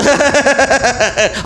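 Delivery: long stuttering put-on cackle; exaggerated he-he-he laugh; mock comedy-show laugh. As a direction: imitate a TV viewer laughing helplessly. Mimics laughing at comedy shows.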